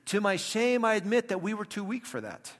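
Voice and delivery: loud, high mock confession; exaggerated sarcastic admission, pitched high. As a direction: feign shame with heavy sarcasm. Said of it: "He's being sarcastic".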